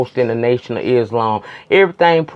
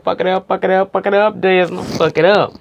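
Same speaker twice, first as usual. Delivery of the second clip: higher, rhythmic chant; egging her on; 5 semitones higher than usual. Retold or imitated. Reviewer cheering Desna on, egging her on. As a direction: cheer her on with each repeat.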